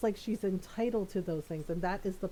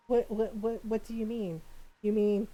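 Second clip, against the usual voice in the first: ordinary talk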